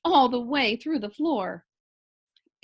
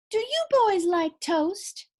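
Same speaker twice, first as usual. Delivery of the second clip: sweet motherly; 7 semitones higher, slower than usual. A narrator's reading. Missus Watson offers breakfast kindly.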